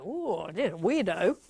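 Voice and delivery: slow, drawn-out mock unease; wary stranger. Stranger backing away from a gusher, slow wary judgement. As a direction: drag it out, put off by the gusher. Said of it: "'Cause they go".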